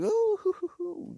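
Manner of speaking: thin, high hooting in mock fright; high "ooh-hoo-hoo". Acts reaction to alien coming close.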